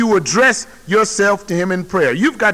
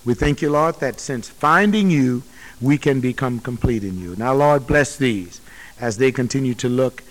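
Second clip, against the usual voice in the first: lower, hushed prayer voice; closing prayer; 7 semitones lower than usual. Acted. Closes the sermon in prayer.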